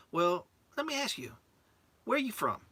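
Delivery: put-on high-pitched Southern-accented questioning. Instructor questioning the trainee, high Southern accent. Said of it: "think I got an accent".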